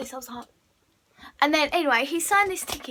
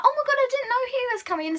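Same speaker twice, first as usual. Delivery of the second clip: fast, higher excited gush; excited squeal; 8 semitones higher, faster than usual. Retold or imitated. Friends spot Ollie unexpectedly, fast excited gush. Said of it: "we was like".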